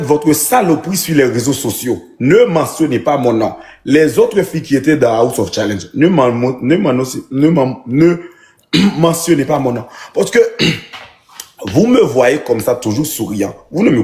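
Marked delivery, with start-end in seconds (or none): none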